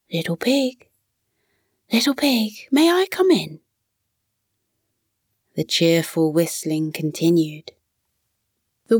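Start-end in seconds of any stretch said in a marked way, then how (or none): 0.1-3.6 lightly voiced wolf calling softly, pitched up; a gentle, high sing-song call through the door. A narrator's reading. Wolf at the straw house door, calling softly to the pig. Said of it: "Softly he called out".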